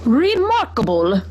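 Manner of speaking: slow, snorted horse voice; a horse snorts his approval; snorting horse. A narrator's reading. Horse Mister Brown reacts to the map, slow snort. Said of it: "snorted Mister Brown".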